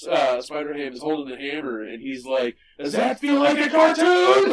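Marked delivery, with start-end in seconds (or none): 2.8-4.5 very loud, high cartoon-hero yell; imitated triumphant shout. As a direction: belt out the punchline in character. Acts out Spider-Ham's triumphant line.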